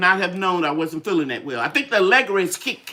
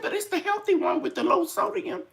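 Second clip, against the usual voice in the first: lightly voiced neighbor, much higher; a higher, coaxing hint of Miss Beverly; quoting the neighbor in a raised, lightly put-on voice; voicing the neighbor; 13 semitones higher than usual. Neighbor Beverly quoted urging soup.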